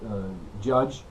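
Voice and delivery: ordinary delivery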